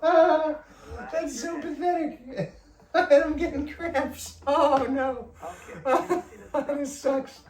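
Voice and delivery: high, laughing through the strain; high strained laughter over tight hamstrings; laughing in pain. Real, not acted. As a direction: giggle helplessly at yourself. Laughing at her own stiffness.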